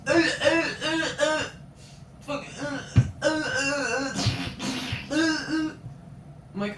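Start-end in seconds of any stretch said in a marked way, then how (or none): 0.1-1.4 loud, high mock moans; repeated high-pitched "uh" noises, mimicking; imitated wordless grunts. Imitates classmate's wordless reply. 3.2-5.7 loud, high mocking "uh" noises; imitated wordless grunts. Imitates classmate's wordless reply.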